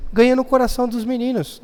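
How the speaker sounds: ordinary delivery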